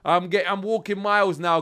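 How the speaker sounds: usual speaking voice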